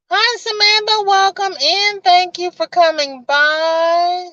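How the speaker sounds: high, bright sing-song hello to a new viewer; excited greeting. Real, not acted. Host greeting Samantha joining the stream, excitedly high. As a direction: lift the voice way up to welcome her in.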